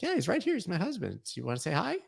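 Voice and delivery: spring the surprise sweetly on the stranger; teasing prank host. Pretend. Voicing Emmy in pretend prank.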